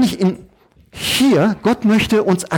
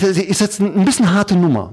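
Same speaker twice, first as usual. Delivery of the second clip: usual speaking voice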